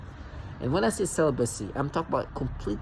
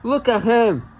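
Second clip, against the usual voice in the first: mock gushing; 7 semitones higher, 5 dB louder, slower than usual. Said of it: "you'll be like".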